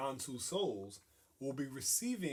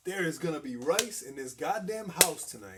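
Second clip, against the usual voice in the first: determined grandma; 5 semitones higher, 6 dB louder than usual. Retold or imitated. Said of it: "she was just like".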